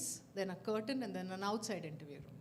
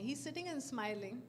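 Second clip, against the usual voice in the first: ordinary talk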